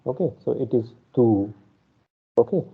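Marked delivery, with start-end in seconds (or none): 1.1-1.5 "tu" with a little stress on the vowel. Shown as a demonstration. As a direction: lean on the u in "tu". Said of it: "give a little bit stress".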